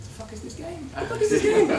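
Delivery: high, rising to loud laughing cry; disbelief. Real, not acted. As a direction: exclaim it in shocked laughter. Shocked at game event.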